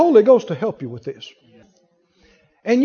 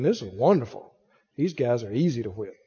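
lower, quieter gloating devil; 8 semitones lower, 10 dB quieter than usual. Pretend. Devil thinking to himself, lower quiet gloating. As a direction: gloat low like a pleased devil. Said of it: "He's thinking".

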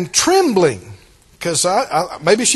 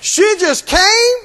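preaching shout; 7 semitones higher, 3 dB louder than usual. Acted.

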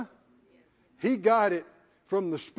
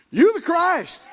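higher and louder, bursting with conviction; excited declaration; 8 semitones higher, 7 dB louder, slower than usual. Retold or imitated. Peter declares to Jesus, loud and thrilled. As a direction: proclaim it like you can't hold it in.

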